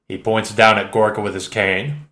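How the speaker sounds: plain reading voice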